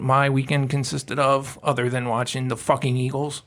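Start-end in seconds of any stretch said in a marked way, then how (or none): none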